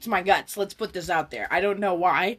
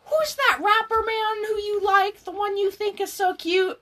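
imitating grandma; 11 semitones higher than usual. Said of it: "She goes".

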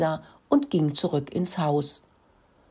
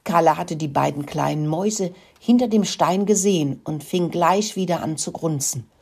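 ordinary delivery

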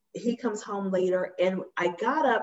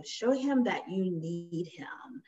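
hushed whisper to herself; a whispered pep talk to herself; 7 dB quieter, slower than usual. Retold or imitated. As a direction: whisper the advice under the breath. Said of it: "And so I was like".

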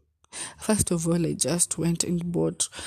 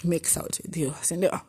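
usual speaking voice

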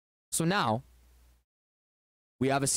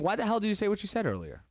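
quick, higher, flat-faced challenge; challenging, straight-faced; 6 semitones higher, faster than usual. Acted. Husband sits down and challenges his wife, quick and straight-faced. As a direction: rattle it off at her like a dare. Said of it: "with a straight face, and just saying".

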